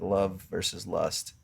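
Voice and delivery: normal talking voice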